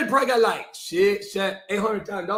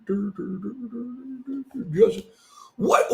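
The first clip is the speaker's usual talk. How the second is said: ordinary talk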